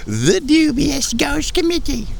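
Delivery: very high, slow show-title announcement; announcer voice. Acted. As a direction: sing out the show name like a jingle.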